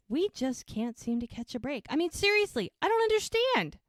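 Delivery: rising to a high, loud complaint; acted exasperation, climbing in pitch; imitated frustration. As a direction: complain as if fed up. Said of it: "She's frustrated".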